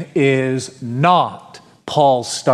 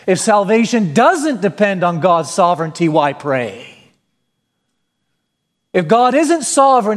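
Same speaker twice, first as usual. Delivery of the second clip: raised, higher full-force sermon voice; fervent preaching; 5 semitones higher than usual. Acted. Pastor urging the church to witness and pray, fervent preaching. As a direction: preach it out, urgent and rising.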